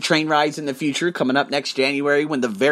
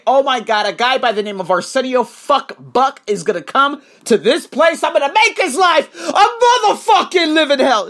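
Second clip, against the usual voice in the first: much higher and louder, hammed-up evil plotting; mock scheming villain; 9 semitones higher, 6 dB louder than usual. Mocking the idea the clerk planned to ruin his day, hammed-up villain rant. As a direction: ham up a cartoon villain announcing his plan. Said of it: "It's not that they walked in and say".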